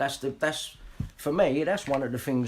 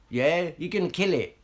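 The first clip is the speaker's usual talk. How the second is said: normal talking voice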